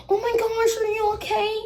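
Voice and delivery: very high imitated voice of concern; fussing teacher. Teacher runs to fallen Samantha, high fussing voice. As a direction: pitch it way up, mock-fussing.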